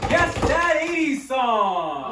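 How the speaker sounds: loud, high, drawn-out shout; big stretched-out title reveal; game-show announcement. Pretend. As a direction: announce it to the whole room. Host announces the game's name.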